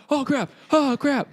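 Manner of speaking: high-pitched mock panic; retold alarm. Nephew watching his uncle's fight break out, retold alarm. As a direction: react in squeaky dismay, half-playing it.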